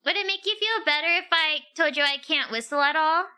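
ordinary talk

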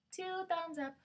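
high-pitched, drawn-out thumbs-up cheer; squeaky-high mock cheer; playful cheer. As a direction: pitch it way up and let it fall playfully. Playful shout-out to channel.

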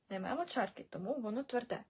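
normal talking voice

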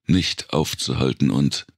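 usual speaking voice

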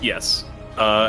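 normal talking voice

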